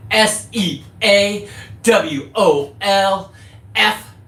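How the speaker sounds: slow, letter-by-letter spelling of the handle; deliberate channel-name spelling. Real, not acted. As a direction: spell it out slowly for viewers. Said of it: "spelling out channel name".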